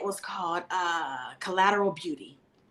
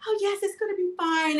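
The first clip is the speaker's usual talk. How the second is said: mock guru cheer; 10 semitones higher, 4 dB quieter than usual. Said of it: "the spiritual guru that was".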